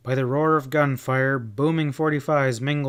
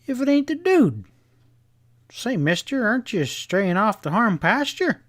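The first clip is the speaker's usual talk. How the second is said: cowboy voice; 10 semitones higher, 4 dB louder than usual. A narrator's reading. Cowboy voice teasing the dude.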